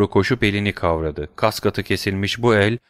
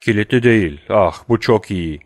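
plain reading voice

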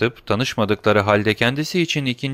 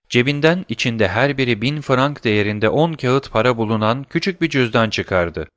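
plain reading voice

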